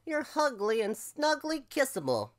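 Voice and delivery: high, affectionate crow voice; cawing crow. Acted. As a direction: coo fondly as a crow. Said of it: "cawed the Crow".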